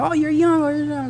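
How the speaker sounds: high mock voice for "Oh, you're young"; imitating people. Podcast guest mocking people who call him young, high-pitched. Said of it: "people still sometimes".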